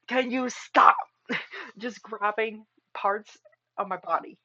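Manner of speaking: half-laughing, loud mock outrage; loud protest. Streamer protesting to the character grabbing her, loud and indignant.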